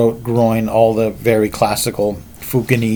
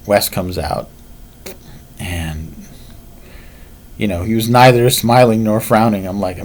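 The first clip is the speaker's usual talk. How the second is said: normal talking voice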